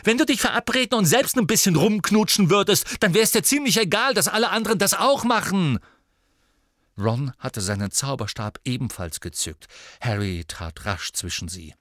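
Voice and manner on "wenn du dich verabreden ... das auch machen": much higher, louder, fast at the end; raging tirade. Acted. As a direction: pour contempt on him. Ginny rages at her brother.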